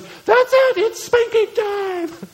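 high, loud, drawn-out mock yell; hammed-up mother at the end of her rope; mom snapping. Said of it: "she snaps and goes".

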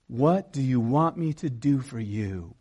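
low, slow, soft question; Jesus. Acted. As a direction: offer help gently and unhurriedly. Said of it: "he called to them".

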